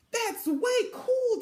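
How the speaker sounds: high, slow, impressed; declared. A narrator's reading. Big sister impressed by Velma's trip news, high and slow. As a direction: stretch out the cool with big-sister approval. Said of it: "declared Frida".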